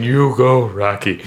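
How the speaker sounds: lightly voiced dead mentor in a movie; a hint of echoey movie ghost. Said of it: "In the echoey voice".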